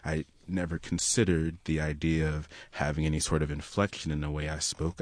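ordinary talk

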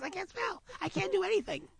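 high, whiny rabbit with a cold; high pleading rabbit voice; stuffy-nosed rabbit. Acted. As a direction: play a sly rabbit faking a cold. Rabbit wiggling nose.

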